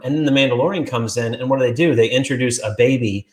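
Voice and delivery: ordinary talk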